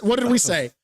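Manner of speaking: normal talking voice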